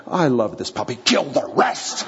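gentle, then suddenly a hammed-up murderous order; mock bloodthirsty. Girl choosing a puppy, mock bloodthirsty turn at the end. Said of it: "What she's not saying is".

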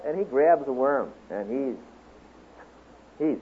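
usual speaking voice

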